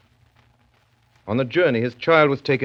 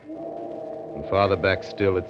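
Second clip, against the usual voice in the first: brooding story narration. Acted. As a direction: draw the listener into a gloomy tale.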